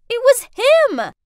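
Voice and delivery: loud, high, slow outburst; outraged realization. Acted. Narrator realizing her ex staged the scare, outraged. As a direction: hit it hard as the culprit dawns on you.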